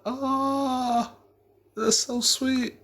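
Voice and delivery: high-pitched mock cooing; unmoved girlfriend. Girlfriend reacting to his proposal without being into it, mock sweet. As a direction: put on a high, insincere little coo. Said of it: "no tears".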